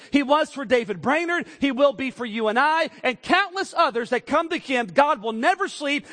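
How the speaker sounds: loud, high, climbing preacher's cry; full-throated revival preaching; preaching at full force. Acted. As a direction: lift it up and preach it hard.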